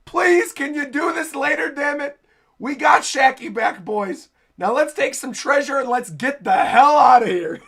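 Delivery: high, loud comic pleading; worked-up crewmate hollering over everyone; exasperated pleading. Acted. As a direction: beg the lovebirds to hurry up, loudly. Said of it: "crying in the front".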